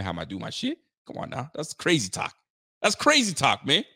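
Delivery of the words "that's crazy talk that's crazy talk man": ordinary talk, nothing marked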